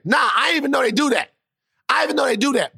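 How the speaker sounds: high, shouted indignation; outraged dad. Retold or imitated. Dad learns of school paddling, high indignant shout.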